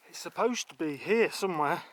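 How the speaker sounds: breathless while climbing; out of breath, panting words. Real, not acted. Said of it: "I'm a bit out of breath".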